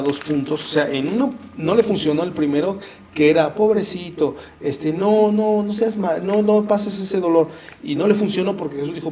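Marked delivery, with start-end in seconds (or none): 3.5-7.5 higher, cooing fake sympathy; mock pity. Devil tempting Jesus, higher cooing mock pity. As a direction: coo like a tempter feigning concern.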